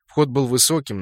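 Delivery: usual speaking voice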